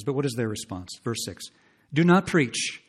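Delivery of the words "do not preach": high, loud scornful command; mocking quote. Preacher mimicking defiant people silencing the prophet, loud. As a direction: throw their words back at them. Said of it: "Thus they preach".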